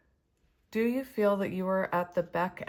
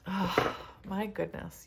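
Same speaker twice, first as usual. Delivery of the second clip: ordinary delivery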